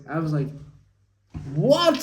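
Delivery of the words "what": incredulous what. Retold or imitated. Reaction to restaurant answer retold.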